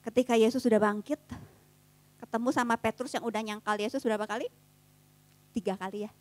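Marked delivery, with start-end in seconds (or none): none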